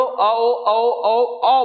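अ and ओ alternated as clear drawn sounds; vowel pronunciation demo. Shown as a demonstration. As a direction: chant अ, ओ to show how they merge. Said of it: "अ बोलेंगे".

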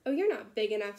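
loud, scoffing dismissal; grouchy ladybug. A narrator's reading. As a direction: brush them off grumpily. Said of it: "said the grouchy ladybug".

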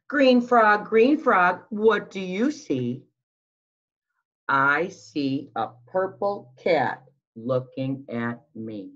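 put-on sing-song storytime voice for little kids; picture book read-aloud. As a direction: read it like circle time, lilting and slow. Reading picture book aloud to children.

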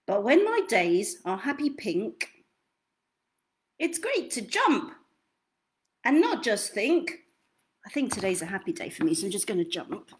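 lively storybook read with big swings; reading to children. Acted. Reads picture book aloud.

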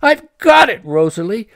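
high, loud eureka cry to Rosalie. Acted. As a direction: shout it out, he just found the answer. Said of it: "he cried loudly".

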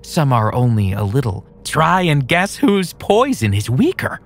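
high, taunting psycho; sinister playful menace; creepy villain voice. Acted. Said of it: "he said".

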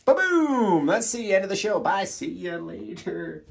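high, loud showman's catchphrase; hyped sign-off. Acted. Host announcing the end of the show, hammed-up. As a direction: punch out a booming goodbye.

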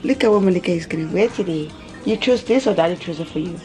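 ordinary talk

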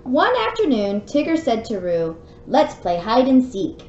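lively read-aloud storybook voice; story narration. A narrator's reading. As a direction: read it out like bedtime for a little one. Reads storybook to child.

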